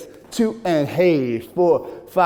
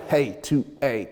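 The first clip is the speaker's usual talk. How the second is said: ordinary talk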